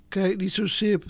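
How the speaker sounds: ordinary delivery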